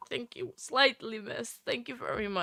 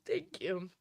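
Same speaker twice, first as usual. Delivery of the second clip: tearful, breathy thank you; choked-up whispered thanks; teary whisper; 3 semitones lower, 6 dB quieter than usual. Real, not acted. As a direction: whisper it through real tears. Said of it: "make me cry even more hard now".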